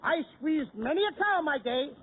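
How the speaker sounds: ordinary delivery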